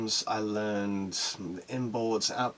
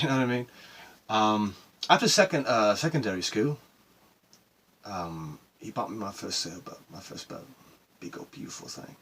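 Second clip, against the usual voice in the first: normal talking voice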